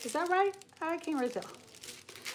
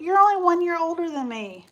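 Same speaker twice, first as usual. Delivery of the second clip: surprised; 5 semitones higher, 8 dB louder than usual. Real, not acted. Realizes viewer is her age.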